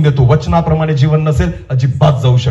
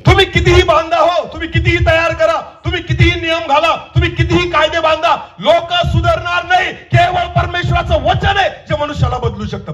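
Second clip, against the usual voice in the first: high, chant-like preacher's shout; fervent preaching; 14 semitones higher than usual. Real, not acted. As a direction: hammer each clause on one high pitch. Preaches at full force. (एकाच चढ्या सुरात आवेशपूर्ण प्रवचन)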